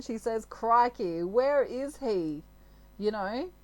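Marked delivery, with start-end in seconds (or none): 0.4-2.4 loud, drawn-out Australian complaint; exasperated Australian woman. Retold or imitated. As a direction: play an Australian woman loudly wondering where he is. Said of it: "If she's an Australian woman".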